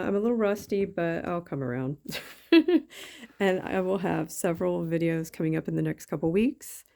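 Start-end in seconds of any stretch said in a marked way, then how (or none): 2.0-3.3 a high, loud self-conscious laugh; embarrassed giggle. Real, not acted. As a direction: laugh off your own rustiness. Laughs at being rusty.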